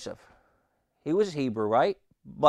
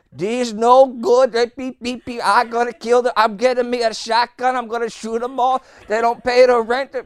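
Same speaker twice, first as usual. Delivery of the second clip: furious rant; 9 semitones higher, 7 dB louder than usual. Retold or imitated. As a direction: explode about the tenants. Neighbour raging about tenants.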